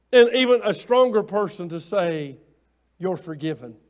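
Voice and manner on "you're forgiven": soft, low and gentle; quiet, tender release; tender forgiveness. Retold or imitated. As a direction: hush it like granting pardon. Voicing the forgiving words.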